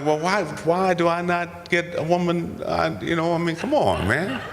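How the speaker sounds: high-pitched, hammed-up grievance; playful whine of feeling left out; mock complaint. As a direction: plead your case in comic protest. Acted complaint to the show's makers.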